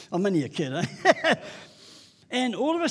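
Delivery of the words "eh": laughing. Real, not acted. Laughing at own story.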